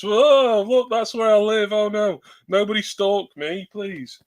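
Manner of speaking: high-pitched, loud mock alarm; hammed-up alarmed outburst; mock panic. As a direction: pretend to panic about being found, dying down at the end. Joking panic at showing own address.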